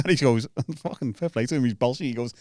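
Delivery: normal talking voice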